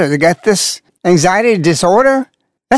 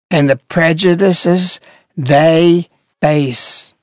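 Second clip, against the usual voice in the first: slow quoting; 3 semitones lower, much slower than usual. Retold or imitated. Rereading the definition aloud, slow and pointed. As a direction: drag out the quoted words slowly. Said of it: "the definition says".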